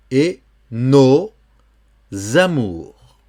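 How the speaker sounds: "et nos amours" broken up slowly, "amours" starting with a Z; liaison with Z. Shown as a demonstration. Teacher showing the liaison to a learner, Z-linked "nos amours". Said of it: "comme si c'était un Z".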